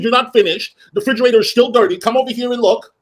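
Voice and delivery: high, insistent ordering; retold scolding. Boss shows housekeeper the dirty refrigerator, exasperated scolding. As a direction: order him over to see the mess.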